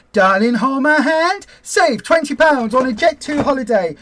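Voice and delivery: high, loud sing-song ad voice; mock advert voiceover; mimicking the advert. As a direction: parody the cheesy advert line. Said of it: "I've heard fifty million times".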